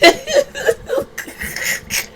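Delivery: real high-pitched burst of laughter; amused squeal of a laugh. Real, not acted. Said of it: "I'm laughing".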